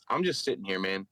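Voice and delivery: understated, imitated stern reply; mildly stern, matter-of-fact put-down; stern statement. Imitating a woman answering the comic on stage, mildly stern. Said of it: "the most stern statement".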